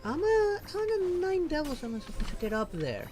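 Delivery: ordinary talk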